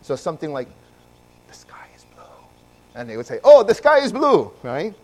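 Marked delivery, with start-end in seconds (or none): 1.5-2.2 soft breathy whisper, no voice. Retold or imitated. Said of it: "she would whisper something". 3.4-4.5 put-on high, loud kid answer calling back; loud repeat. Kids repeat whispered test aloud.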